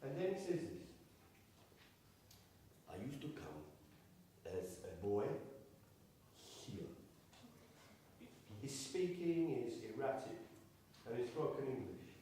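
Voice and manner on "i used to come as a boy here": soft memory. Retold or imitated. Hakim's memory, said softly.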